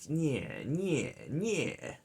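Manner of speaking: soft mock creature "nyeh", falling each time; imitated creature noise. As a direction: imitate a game monster's repeated little cry. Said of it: "she's always like".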